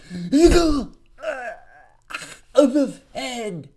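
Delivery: ghoul face voice. Acted. Mocking the Fed official to viewers, hammed-up ghoul voice. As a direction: ham up "the ghoul of the Fed", slow at the end. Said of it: "I gotta make the face".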